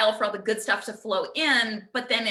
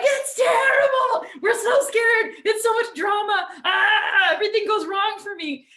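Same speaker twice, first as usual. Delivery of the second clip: high, loud, laughing mock wail of doom; hammed-up panic; 12 semitones higher, 6 dB louder than usual. Us humans overreacting to our own gift, hammed-up wailing. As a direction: overdo the drama like a whiny kid.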